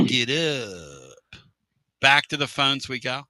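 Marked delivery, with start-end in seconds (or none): 0.0-1.4 slow, drawn-out "look it up"; teacher impression. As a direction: drag out each word like the old teacher. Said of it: "Sounds like a Pruitt impersonation".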